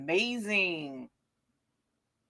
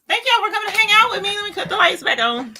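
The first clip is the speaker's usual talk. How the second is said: excited; 13 semitones higher, 4 dB louder, faster than usual. Real, not acted. Rushed high thanks.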